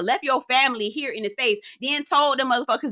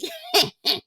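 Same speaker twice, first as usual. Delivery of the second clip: sudden high cackle; real amused laugh. Real, not acted. Laughing at husband's phrasing.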